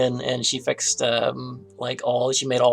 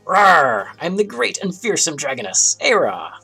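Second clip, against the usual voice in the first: big roaring dragoness voice; 5 semitones higher, 8 dB louder than usual. Acted. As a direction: roar it out like a proud dragon. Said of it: "here I go".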